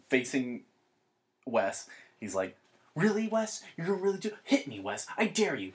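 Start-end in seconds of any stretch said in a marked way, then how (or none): 2.9-5.7 high, goading imitation of a friend; mock taunting. Drunk friend dares sleepy friend to hit him, taunting. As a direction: dare him, pitched up and mocking.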